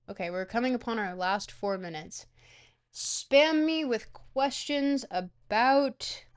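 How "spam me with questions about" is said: drawn out. Real, not acted. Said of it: "drawn-out request to chat".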